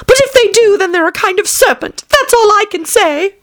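high falsetto, dismissive bird; stubborn pigeon. Acted.

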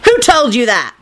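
indignant high-pitched yell. A narrator's reading. Lupin demanding who told Neville, yelling. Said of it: "Lupin yelled".

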